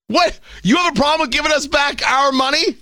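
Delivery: high, disbelieving what?; mock disbelief, voice shooting up; outraged host scoffing at the chairman; incredulous outrage.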